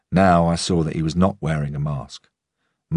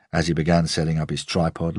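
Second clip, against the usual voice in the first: plain reading voice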